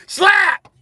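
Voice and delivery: very high, loud vocal slap sound; cartoon slap; slap noise. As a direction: smack the word out high and sharp. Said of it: "He slapped the shit out of Charlie Murphy".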